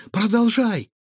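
high-pitched, eager urging; overjoyed. A narrator's reading. Girl urging boy to keep prying the door, overjoyed. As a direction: urge him on with delight. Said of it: "обрадовалась Илона".

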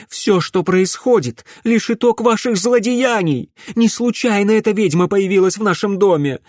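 high, loud, indignant accusations; angry tirade. A narrator's reading. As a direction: pour out outrage at full pitch. Mother accuses them in quarrel. (гневная обвинительная тирада)

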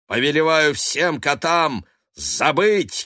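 "повелеваю всем котам забыть" shouted high and slow, stress on забыть; loud incantation. Acted. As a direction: command it like a sorcerer. Curse spell cast.

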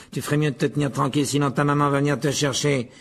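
quick, scornful taunt; contemptuous. Acted. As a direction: needle him fast, with contempt. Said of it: "d'un ton méprisant". (raillerie méprisante et rapide)

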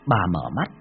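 usual speaking voice

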